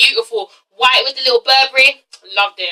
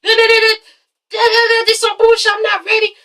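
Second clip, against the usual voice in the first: hammed-up imitation of an angry husband; mock ranting Apollo; 9 semitones higher than usual. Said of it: "He was like".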